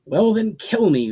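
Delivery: defiant shout, high and loud. A narrator's reading. Ushara shouting at the man to strike. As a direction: dare him to kill her, full voice. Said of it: "Ushara shouted".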